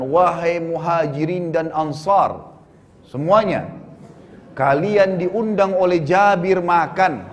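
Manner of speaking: drawn-out mock public call, words stretched slowly; proclaiming shout. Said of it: "lalu beliau teriak mengatakan".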